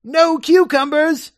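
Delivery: squeaky high, slow shock; mock-horrified gentleman; Algernon. Algernon aghast at his butler, squeaking high and slow.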